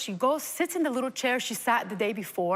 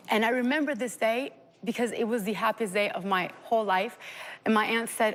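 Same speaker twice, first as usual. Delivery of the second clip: ordinary talk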